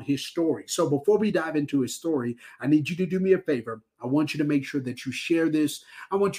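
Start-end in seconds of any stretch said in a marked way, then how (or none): none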